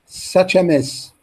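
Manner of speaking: "such a mess" said slowly with weight; drawn-out teacher demo of the phrase; slow, careful modelling for learners; slow model reading. Shown as a demonstration. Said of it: "copy the stress and intonation".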